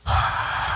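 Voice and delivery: long, voiceless breath out; wordless sigh of disbelief; heavy sigh. Retold or imitated. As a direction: let out an overwhelmed sigh. Retelling stunned moment at gift.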